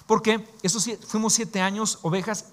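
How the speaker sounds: ordinary delivery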